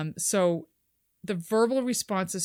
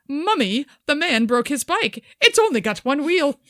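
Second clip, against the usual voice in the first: put-on little boy to mummy; 5 semitones higher, 4 dB louder than usual. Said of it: "Boy of three to four to his mother".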